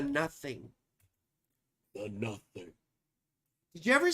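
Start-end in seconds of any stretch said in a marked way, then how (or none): 1.9-2.7 low and soft, dread in it; hushed, lower menacing take; ominous movie voice. Pretend. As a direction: breathe it like a dark omen. Repeating the film phrase.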